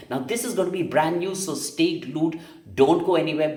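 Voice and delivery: big call to stay tuned; urgent plea. Real, not acted. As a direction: hold them in their seats. Urging viewers to stay.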